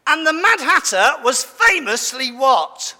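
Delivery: ordinary delivery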